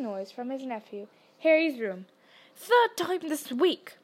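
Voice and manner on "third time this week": a mild, slowed mock roar; lightly played angry uncle, drawn out; understated roar across the table. A narrator's reading. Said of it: "he roared".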